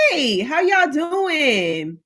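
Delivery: slow, stretched sing-song hello; drawn-out greeting. Acted. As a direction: stretch every word like a warm welcome. Streamer greeting viewers in chat.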